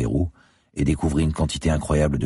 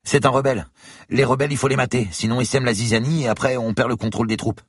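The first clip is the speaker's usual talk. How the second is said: put-on higher, young student's voice; 10 semitones higher than usual. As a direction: voice the eager student. Student character voice. (voix d'étudiant plus aiguë)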